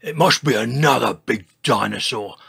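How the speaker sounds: put-on slightly loud old dinosaur voice; mildly uneasy guess. A narrator's reading. Old Triceratops hears distant crashes, uneasy. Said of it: "said George un-, uneasily".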